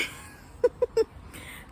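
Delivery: high breathy giggle; real laughter at the story's end. Real, not acted. Vlogger laughing as she wraps up the bus story.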